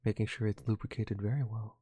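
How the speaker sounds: quiet, low golf commentator murmur; lightly voiced hushed golf broadcaster; golf announcer. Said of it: "Just do my golf announcing the entire time".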